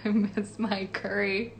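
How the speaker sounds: ordinary talk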